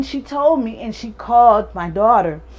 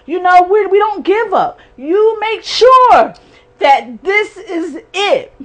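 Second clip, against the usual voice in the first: rising, louder desperate plea; mother urging her daughter on the phone; pleading with daughter; 6 semitones higher, 6 dB louder than usual. Retold or imitated. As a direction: beg her not to let go.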